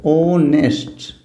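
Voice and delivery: "ऑनेस्ट" drawn out slowly from a long opening o; slow silent-H model. Shown as a demonstration. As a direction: stretch "ऑनेस्ट" out, no h. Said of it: "इसको हम बोलेंगे". ("ऑनेस्ट" धीरे, खींचकर, बिना ह के)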